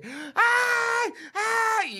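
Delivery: high, loud squeal of a panicking woman; hammed-up scared ay; mock hysterical shriek. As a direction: shriek like someone seeing a rat. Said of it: "muchas mujeres de que".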